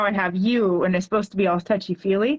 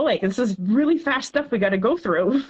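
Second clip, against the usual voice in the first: usual speaking voice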